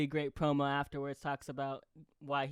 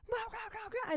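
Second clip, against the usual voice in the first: very high, soft mock meows; imitating a cat meow; 4 dB quieter, faster than usual. Fan imitating the entrance theme, high soft meowing. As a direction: squeak it out like the entrance music. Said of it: "you just hear".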